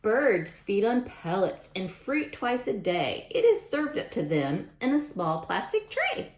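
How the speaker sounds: lively picture-book read-aloud; sing-song storytime rhyme; reading to children. A narrator's reading. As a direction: read the rhymes brightly to a class.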